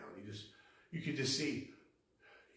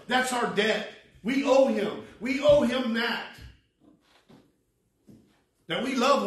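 louder, higher pulpit delivery; forceful preaching; 7 semitones higher, 9 dB louder than usual. Real, not acted. Preacher telling the congregation who their neighbors are, emphatic preaching. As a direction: press each point home to the congregation.

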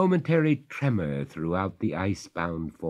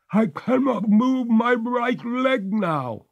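very high, slow, despairing ghost; despairing cry; 6 dB louder, slower than usual. A narrator's reading. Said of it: "cried the ghost in despair".

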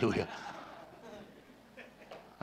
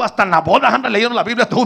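louder scolding uncle's complaint; angry uncle; 7 semitones higher, 11 dB louder than usual. Retold or imitated. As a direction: tell him off, annoyed. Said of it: "estaba enojado de veras". (tío enojado regañando)

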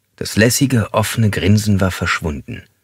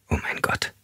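breathless whisper, much softer; terrified whisper; 10 dB quieter than usual. Acted. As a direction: whisper in panic under the towel. Suffocating woman's terrified thought. (panisch geflüstert)

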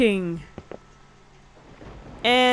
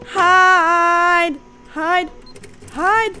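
high, pressed urgent repeats, louder; urgent panic; 9 semitones higher, 5 dB louder, much slower than usual. Real, not acted. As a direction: urge yourself to hide, frightened. Urging herself to hide.

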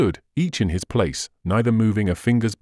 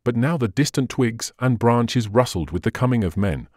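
usual speaking voice

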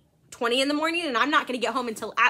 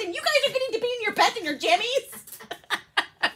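laughing mock scold; 9 semitones higher than usual. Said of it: "I said".

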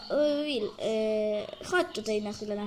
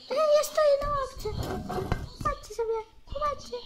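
excited calling out; 12 semitones higher than usual. Pretend. Child showing off on bench.

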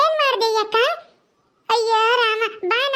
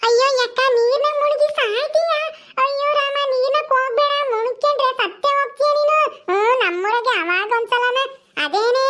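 put-on childlike Paddu, alarmed. Paddu begs Gowrakka not to drown herself, childlike alarm. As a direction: plead naively with an elder sister, stretching the words.

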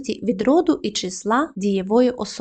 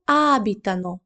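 abitano with the stress back on the opening A; stress on first vowel. Shown as a demonstration. Teacher showing learners the stress shift, abitano stressed on its first vowel. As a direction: lean on the first A of abitano, rest unstressed. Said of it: "наголос повернувся на першу голосну".

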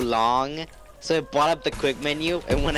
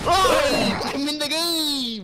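slow excited yell; excited shout; 8 semitones higher, much slower than usual. Real, not acted. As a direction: shout it out thrilled.

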